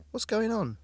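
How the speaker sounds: high, worried question; alarmed. A narrator's reading. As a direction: ask in sudden alarm. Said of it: "Hannah woken at night".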